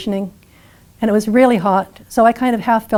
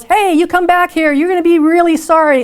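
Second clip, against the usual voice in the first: higher mock yell of an enraged trucker; retold yell; 7 semitones higher than usual. Truck driver yelling after the fleeing hitchhiker, mock angry yell. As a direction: call after her like a furious driver, higher. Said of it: "jumps out of the truck and yells".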